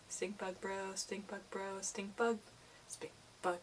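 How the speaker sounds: soft, fading murmur to the bug; trailing off into a near whisper; hushed cooing. Real, not acted. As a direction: coo it under your breath.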